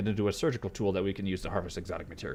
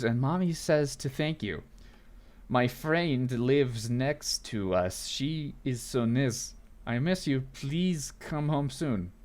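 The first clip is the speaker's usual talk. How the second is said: put-on slow, sing-song child's letter; sweet child voice, drawn-out words; small child writing to Daddy; 3 semitones higher, slower than usual. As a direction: read it haltingly like a little kid, word by word. Reads child's letter aloud.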